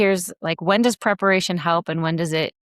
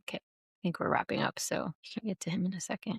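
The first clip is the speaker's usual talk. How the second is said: whispering; 13 dB quieter than usual. Real, not acted. Aside during paused recording.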